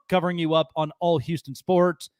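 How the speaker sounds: ordinary talk, nothing marked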